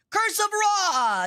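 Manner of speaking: slow, high, hammy spell-cast; dramatic curse cry. Pretend. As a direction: boom out the curse slowly. Goddess casting a curse.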